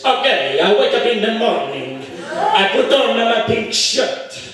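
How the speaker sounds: hammed-up Italian accent, slow. Italian applicant making a sentence for the interviewer, Italian accent. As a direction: joke Italian with a lilting accent. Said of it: "The Italian guy says".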